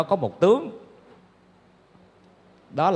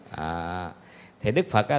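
ordinary delivery

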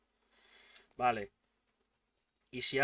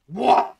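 sudden loud buah of frustration; loud yell; 11 dB louder than usual. Real, not acted. Player yells loudly at the game, then apologizes. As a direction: let a big yell out. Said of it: "Perdón por el grito".

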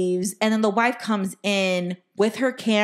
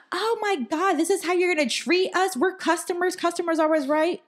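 mock Karen whine; 10 semitones higher than usual. Mocking the Karen guest.

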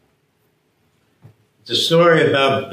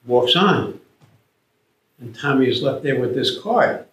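usual speaking voice

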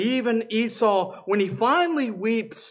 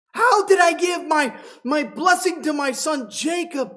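shaken; 8 semitones higher, 4 dB louder than usual. Acted. Preacher voicing Isaac's horror.

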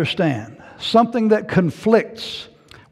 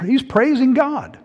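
usual speaking voice

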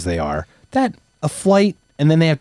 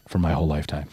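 ordinary talk, nothing marked

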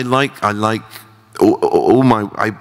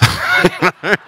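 laugh; 13 semitones higher than usual. Real, not acted. Laughs at own press-up story.

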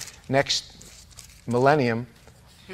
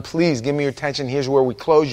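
ordinary talk, nothing marked

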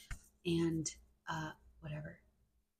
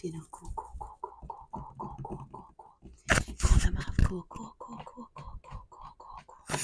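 rapid repeated whispered words; fast whispering asmr. Acted.

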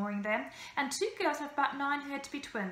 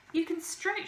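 bearded lady voice; 7 semitones higher than usual. A narrator's reading. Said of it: "she said".

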